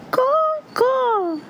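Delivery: very high, drawn-out bird call; piercingly high crow cry; crow caw. A narrator's reading. Crow cries before flying off.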